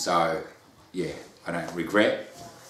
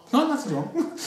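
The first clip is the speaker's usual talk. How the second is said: a much higher, mock-cheery denial; higher, mock-bright nothing's wrong; brushing it off; 4 dB louder than usual. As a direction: chirp it out as if all is fine. Acting his own brushing-off reply.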